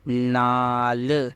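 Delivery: नाल stretched out very slowly; slow reading demo. Shown as a demonstration. Teacher reading a word to students, very slow. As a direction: draw the word out for learners. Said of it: "ये क्या हुआ?".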